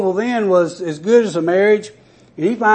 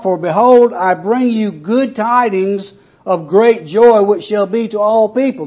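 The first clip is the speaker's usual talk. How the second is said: declaimed scripture; 2 semitones higher, 3 dB louder than usual. Acted. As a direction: lift the verse up to the congregation. Preacher declaims scripture aloud.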